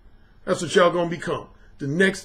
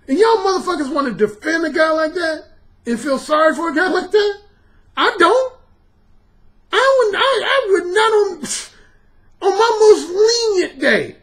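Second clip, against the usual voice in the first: incredulous outburst; 9 semitones higher than usual. Real, not acted. Incredulous rant at defenders.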